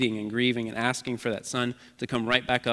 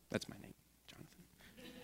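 a hushed, breathy whisper; softly whispered name aside; 24 dB quieter than usual. Real, not acted. Aside while quoting mother.

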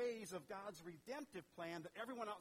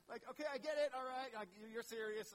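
a touch higher, laughing as he concedes; nervous giving in; 4 semitones higher, 5 dB louder than usual. Pretend. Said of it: "Zechariah conceding, laughing".

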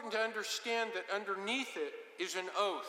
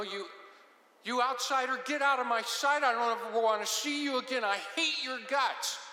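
loud, hateful threat thrown at an outsider; enemy swearing he will destroy the outsider; threatening curse; 4 semitones higher, 3 dB louder than usual. Retold or imitated. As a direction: spit out the threat to drive him away. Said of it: "swear with a threat, such as".

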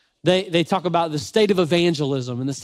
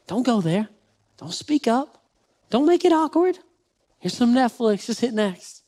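put-on coaxing baby talk; 7 semitones higher than usual. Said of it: "it goes something like this".

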